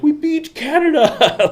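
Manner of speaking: high, slow, exaggerated victory shout; Nicaragua cheering. Vlogger imitating Nicaragua celebrating a win, high mock cheer. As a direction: crow it like the whole country won. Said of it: "Nicaragua was like".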